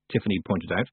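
ordinary delivery, nothing marked

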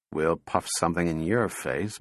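low, soft grumble; muttered. A narrator's reading. Donovan answering the robot's rhyme, muttered. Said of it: "muttered Donovan".

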